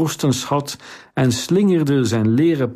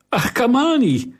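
excited call; 13 semitones higher than usual. A narrator's reading. Kariline recognizes the boy entering.

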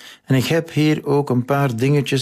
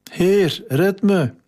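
a much higher, panicked call for help; frightened cry; 9 semitones higher, slower than usual. A narrator's reading. Sinking Peter cries out to Jesus in fear. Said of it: "riep hij".

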